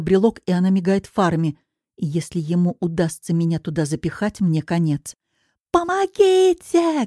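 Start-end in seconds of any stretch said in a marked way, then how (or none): 5.7-7.1 high, long drawn-out scream for help; desperate cry. A narrator's reading. Woman about to be pushed into a car, desperate cry. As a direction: stretch it into a desperate high wail. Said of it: "кричу я отчаянно".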